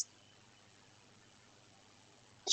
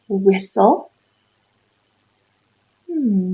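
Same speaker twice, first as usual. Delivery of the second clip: ordinary delivery, nothing marked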